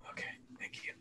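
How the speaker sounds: whispered thanks. Acted. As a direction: barely breathe the words out. Emotional whisper at memorial.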